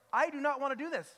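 high, loud retold protest; exasperated refusal, hammed up for an audience; fed-up outburst. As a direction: act out finally having had enough. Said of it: "I finally had enough".

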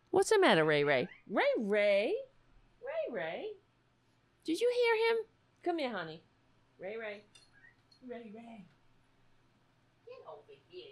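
sing-song high voice fussing over a pet cat. Real, not acted. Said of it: "you have to address it".

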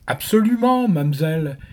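voix de momie, bien plus aiguë et forte; réponds comme un mort qui parle; voix d'outre-tombe. Acted. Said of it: "de sa voix d'outre-tombe". (voix d'outre-tombe du chauffeur momie)

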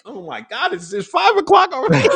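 high-pitched, rising mock disbelief; mock dismay. Acts out dismay at wake-up.

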